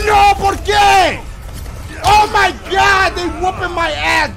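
panicked yell, high and loud; yelling in real alarm; alarm shout. Real, not acted. Ambushed by villagers in game.

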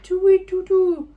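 high, loud, slow hooting owl. Acted. Owl answering the travellers, a high loud slow hoot. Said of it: "He blinked and hooted".

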